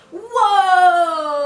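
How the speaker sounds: high, booming whoa sliding down; really loud. Shown as a demonstration. Hips going round in warm-up, really loud. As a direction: call out a long, loud whoa. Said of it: "and now really loudly".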